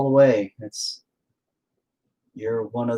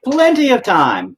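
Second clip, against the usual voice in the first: mock cheerful drawl; 13 semitones higher, 7 dB louder, slower than usual. Shown as a demonstration. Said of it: "Have a better attitude".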